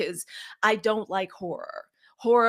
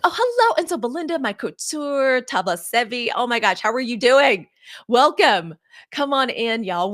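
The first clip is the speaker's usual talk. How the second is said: delighted greeting; 3 semitones higher, 8 dB louder than usual. Real, not acted. Greeting arriving viewers on live.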